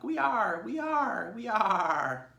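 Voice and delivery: all the sheep agreeing at once; flock in chorus. Acted. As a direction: sheep chanting agreement together. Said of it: "chorused the flock".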